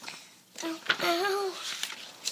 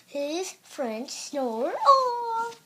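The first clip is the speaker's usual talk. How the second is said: slow drawn out reading; 6 semitones higher, much slower than usual. Real, not acted. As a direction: read it out slowly with emphasis. Child repeats louder, slow.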